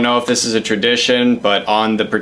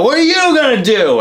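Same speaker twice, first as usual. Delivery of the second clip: much higher taunting imitation of the drunk biker; mock biker challenge; 14 semitones higher, 4 dB louder than usual. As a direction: get in his face with a squeaky dare. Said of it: "and you go".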